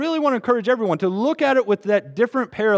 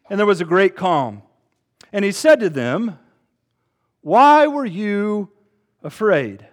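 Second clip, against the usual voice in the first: measured, deliberate Bible reading; scripture reading; 2 semitones higher than usual. Acted. Pastor reading Mark four to the church, measured scripture reading. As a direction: read the passage to the congregation with weight.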